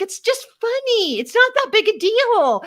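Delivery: high, slow, brushing it off; mock defensive. Wife waving off God's nudge, high and dismissive. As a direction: shrug it off in a high sing-song.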